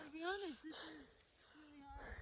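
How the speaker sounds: faint, spent voice; barely voiced from exhaustion; exhausted. Real, not acted. Exhausted climber, barely audible.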